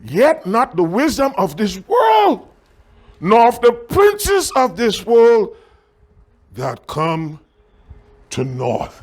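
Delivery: deliberate preacher reading aloud; scripture reading. Acted. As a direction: proclaim the verse, then let it drop away. Reads scripture aloud emphatically.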